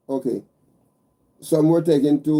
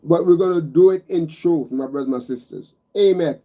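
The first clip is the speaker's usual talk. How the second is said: normal talking voice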